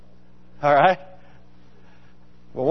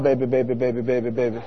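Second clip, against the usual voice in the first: lightly voiced small child coaxing, fading softer; little boy's voice; 5 semitones lower, 5 dB quieter than usual. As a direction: gently mimic a toddler urging the car on. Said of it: "his little voice goes".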